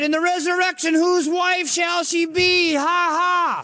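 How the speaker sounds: high, drawn-out taunting voice with fake laugh; mocking Sadducees. Sadducees trying to trip up Jesus, mocking. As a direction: sing out the riddle as a jeer. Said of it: "I thought that was real funny".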